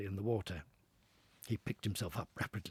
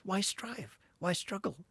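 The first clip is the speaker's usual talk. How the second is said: Toad musing; 9 semitones higher, slower than usual. Acted.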